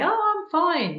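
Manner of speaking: put-on high, light "I'm fine" of a child; brushed-off child. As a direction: toss it off like a child who pretends all is well.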